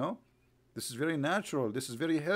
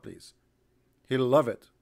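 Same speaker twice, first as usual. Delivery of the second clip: usual speaking voice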